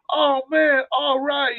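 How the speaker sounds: high, delighted mock voice; high excited imitation of the sister; imitating happy sister. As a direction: squeal it up high like she just got good news. Sister thrilled opening mail.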